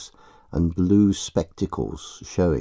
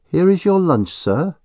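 landlady voice; 10 semitones higher, 5 dB louder than usual. Acted. Landlady voiced serving lunch.

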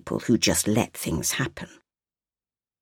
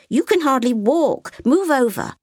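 put-on a curious little girl asking; little girl's voice; 13 semitones higher, 4 dB louder than usual. A narrator's reading. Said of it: "Sophia said".

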